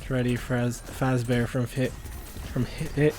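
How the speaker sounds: tripping over the words, restarting; stuttering. Real, not acted. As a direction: stumble and repeat the name. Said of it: "Sorry, I'm stuttering".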